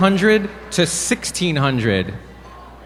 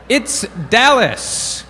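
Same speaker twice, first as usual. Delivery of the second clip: drawn-out reveal; 5 semitones higher, much slower than usual. Acted. Dramatic winner reveal.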